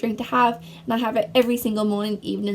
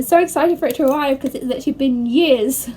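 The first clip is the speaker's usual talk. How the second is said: excited rush, louder and higher; too excited; 3 semitones higher, 5 dB louder than usual. Real, not acted. Vlogger can't wait for the mirror to arrive, excited. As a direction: gush it out, carried away with excitement. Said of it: "I got too excited".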